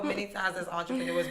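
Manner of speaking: ordinary talk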